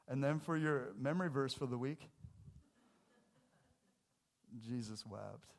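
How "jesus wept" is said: ordinary delivery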